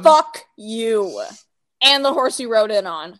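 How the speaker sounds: loud, blunt brush-off; loud mock insult. Real, not acted. Friend fed up with theory talk, loud. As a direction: shout it at your friend.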